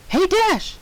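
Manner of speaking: high, chirpy call; high piped pony call; piping Pinkie. Acted. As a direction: pipe it up brightly. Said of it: "she piped up".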